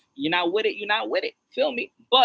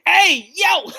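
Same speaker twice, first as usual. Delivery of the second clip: high, loud scandalized yell; mock shock; 14 semitones higher, 7 dB louder than usual. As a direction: call out as if hearing something dirty. Mock shocked reaction to verse.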